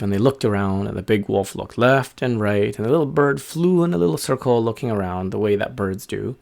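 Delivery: plain reading voice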